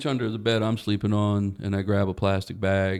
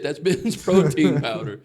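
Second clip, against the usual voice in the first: laughing disbelief; 3 semitones higher, 5 dB louder, slower than usual. Retold or imitated. Repeats it, cracking up.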